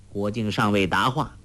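usual speaking voice

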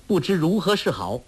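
plain reading voice

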